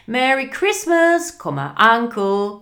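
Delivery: high, loud cheerful greeting; happy cry. Acted. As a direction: call it out brightly. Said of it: "cried a happy voice".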